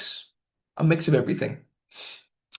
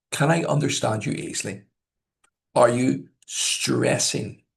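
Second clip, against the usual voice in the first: usual speaking voice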